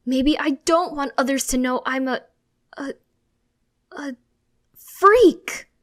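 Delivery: very high and loud, breaking on freak; anguished outburst. Acted. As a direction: stammer then explode. Son angrily confronting mom.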